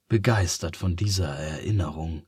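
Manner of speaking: plain reading voice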